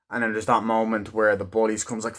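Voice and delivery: normal talking voice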